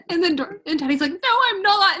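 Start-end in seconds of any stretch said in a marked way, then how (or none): 1.1-2.0 high, loud imitation of a tearful denial; Teddy, mock bawling. Retelling a castmate denying she will cry, mock bawling. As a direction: play up a bawling denial, pitched way up. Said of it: "And she's already bawling".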